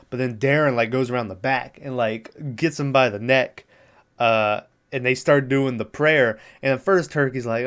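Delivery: normal talking voice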